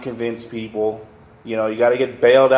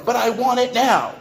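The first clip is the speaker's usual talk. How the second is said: put-on bratty child whine; 10 semitones higher than usual. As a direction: whine like a spoiled kid denied a toy. Brat voiced throwing a fit.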